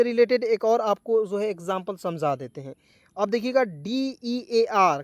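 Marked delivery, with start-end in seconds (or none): 3.2-5.0 E A R drawn out with a pause after each; dictating the letters at half speed; slow spelled letters. Shown as a demonstration. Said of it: "क्या पढ़ेंगे दोस्तों".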